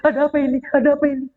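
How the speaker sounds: mock bewildered stammer into a question; confused panic. Storyteller plays Abraha's baffled troops to children, confused panic. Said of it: "para pasukan Abraha kebingungan".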